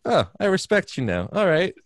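high-pitched mock respect; patient caving to the therapist in a high mock-meek voice. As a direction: squeak it out like a humbled kid. [name] suddenly submits, mocking.

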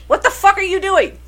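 high, incredulous outburst; exasperated disbelief. Real, not acted. Friend ranting about the bad movie, exasperated disbelief. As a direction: throw up your hands at the screen.

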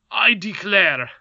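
slow, high, loud pompous declaration; solemn indignation. A narrator's reading. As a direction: declare it with wounded royal dignity. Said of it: "with solemn indignation".

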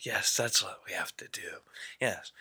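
fast breathy whisper. Acted. Telepathic wizard whispers his instructions to Merlin. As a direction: hiss it secretly, quick and unvoiced.

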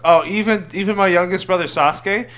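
dismayed. Acted. Itachi learns his family is on the villain's team, dismayed.

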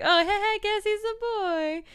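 high, loud breezy crowd voice; hammed-up casual bystanders; dismissive crowd. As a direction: toss it off like a shrugging crowd.

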